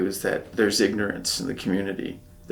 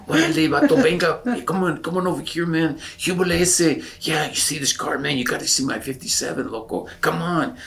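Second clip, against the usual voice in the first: put-on Chicano accent; 7 semitones higher than usual. Lowrider urging actor to see his car, Chicano accent. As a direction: play a lowrider calling you over in a Chicano accent. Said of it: "they speak with an accent like that".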